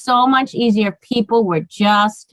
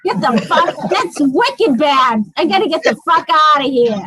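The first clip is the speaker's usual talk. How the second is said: hammed-up Boston accent, higher; 4 semitones higher than usual. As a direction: lay on a thick Boston accent, laughing. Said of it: "That accent comes right out".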